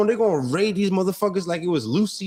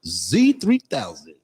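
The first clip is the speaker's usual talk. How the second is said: low mock station-ident voice; radio announcer; 5 semitones lower than usual. Co-host mimicking friend's would-be radio show, announcer voice. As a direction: drop into a put-on radio host voice. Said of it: "like, sports announcing".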